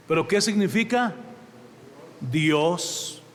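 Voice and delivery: usual speaking voice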